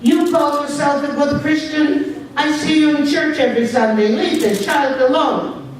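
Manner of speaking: put-on high, scolding old woman's voice; old lady yelling. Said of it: "started yelling".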